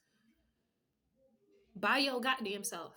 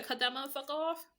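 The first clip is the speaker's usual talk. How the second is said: quoted demand; 5 semitones higher, 4 dB louder than usual. Retold or imitated. Voicing the listener's demands.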